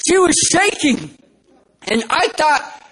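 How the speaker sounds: ordinary talk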